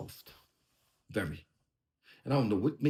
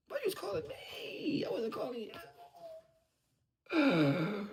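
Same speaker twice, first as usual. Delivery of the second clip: much higher, much softer, slow mimicry of the rival; mocking imitation; 12 semitones higher, 7 dB quieter, slower than usual. Rapper mimicking his rival's denial in a high, soft mock voice. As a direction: mimic him in a small, silly voice.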